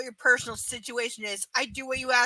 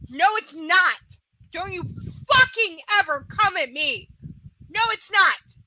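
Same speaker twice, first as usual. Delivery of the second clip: raised, emphatic angry rebuttal; heated and louder; indignant denial; 4 semitones higher, 4 dB louder than usual. Real, not acted. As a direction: shut down the blame forcefully. Answers absent client's blame.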